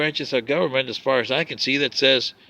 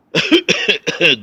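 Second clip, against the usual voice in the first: loud put-on cough before a word; hammed-up, loud throat-clearing fit; mock choked-up cough; 7 dB louder than usual. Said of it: "I get choked up".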